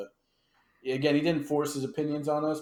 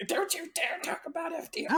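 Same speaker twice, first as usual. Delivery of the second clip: ballistic relatives. Retold or imitated. Grandson imitating mom and grandma defending FDR, mock outrage. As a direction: imitate scandalized family in a squeaky high voice. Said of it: "they just went ballistic".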